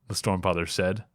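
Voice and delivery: plain reading voice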